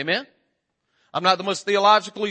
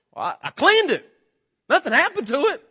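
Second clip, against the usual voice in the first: sheepish husband; 9 semitones higher, 3 dB louder than usual. Retold or imitated. His sheepish reply to wife.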